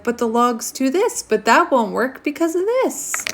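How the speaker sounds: high, whiny mock excuse. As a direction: whine like someone dodging every fix. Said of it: "He's like".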